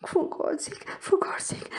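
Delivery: high whispered begging; breathy whispered for God's sake; whispered plea. Acted. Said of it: "मिन्नत समाजत करते हुए".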